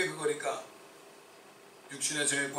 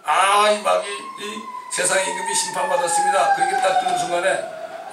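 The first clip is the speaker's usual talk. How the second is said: high, loud cry of discovery; soaring amazed exclamation; ecstatic revelation; 3 semitones higher, 9 dB louder than usual. Retold or imitated. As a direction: burst out as if it just dawned. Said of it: "저는 그걸 알았어요".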